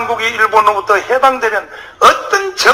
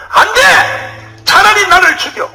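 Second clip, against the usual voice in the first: defiant cry; 9 semitones higher, slower than usual. Retold or imitated. Rhee defying the Americans.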